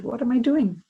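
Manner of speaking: high, loud startled question; sudden surprised what am I doing; puzzled question. Retold or imitated. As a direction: blurt it out, caught off guard. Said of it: "And she said".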